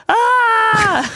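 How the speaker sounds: high cartoonish scream. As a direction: shriek like a cartoon. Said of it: "And we were like".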